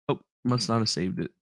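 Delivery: ordinary talk, nothing marked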